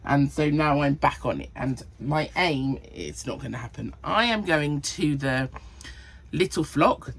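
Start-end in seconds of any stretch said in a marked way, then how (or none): none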